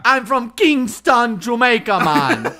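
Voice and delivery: put-on slow, laughing Jamaican accent; mock Jamaican accent. [name] repeats the line in a Jamaican accent. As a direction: stretch Kingston in a hammed-up Jamaican accent. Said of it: "Say this".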